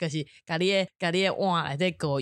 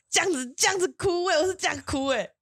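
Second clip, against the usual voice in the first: imitating her crying; 11 semitones higher than usual.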